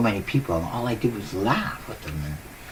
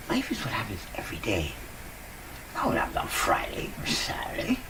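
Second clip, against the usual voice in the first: soft whisper of a secret; whispered wisdom; 5 dB quieter than usual. Real, not acted. Sharing a life lesson.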